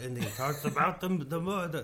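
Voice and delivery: put-on hammy German movie villain accent. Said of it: "It's like a fucking German villain".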